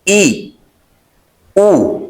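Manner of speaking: ई with mouth dropped, then ऊ with lips rounded; ई and ऊ demo. Shown as a demonstration. Teacher modelling ई and ऊ for students. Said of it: "मुंह नीचे की तरफ होगा आवाज".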